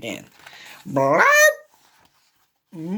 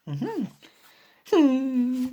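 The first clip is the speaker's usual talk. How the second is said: plain reading voice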